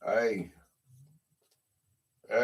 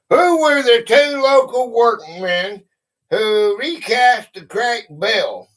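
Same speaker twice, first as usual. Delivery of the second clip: higher, louder quizmaster voice; quiz question read-out; 6 semitones higher, 7 dB louder, slower than usual. Real, not acted. Host puts a history question to players, loud quiz read-out. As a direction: put the question to all the players.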